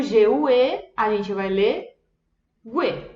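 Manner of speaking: gue said as gweh with the u sounded; Italian gue. Shown as a demonstration. Teacher models gue as gweh.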